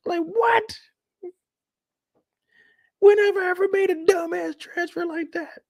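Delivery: very high, indignant squeal; outraged customer. Pretend. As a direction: protest in a high affronted voice. Outraged at bank transfer.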